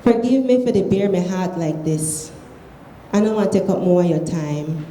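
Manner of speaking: usual speaking voice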